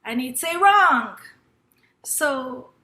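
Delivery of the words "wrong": loud, higher imitated teacher; raised-voice mock correction; teacher's blunt correction. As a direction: call out the verdict like a teacher. Acting out teacher rejecting the answer.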